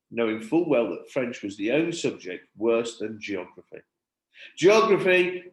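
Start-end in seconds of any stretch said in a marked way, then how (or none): 4.6-5.4 class replying in chorus. A narrator's reading. Class answers together.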